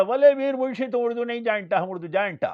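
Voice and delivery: loud, high boasting in broken Urdu; شیخی باز collector. Retold or imitated. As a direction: mimic a foreign official bragging at full volume. Mimics collector boasting in broken Urdu. (Collector کی اونچی، اکڑی ہوئی نقل)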